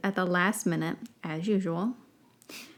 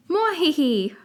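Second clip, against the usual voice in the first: squeaky half cackle; 7 semitones higher, 4 dB louder than usual. Acted. Puffskin nearly gets laugh.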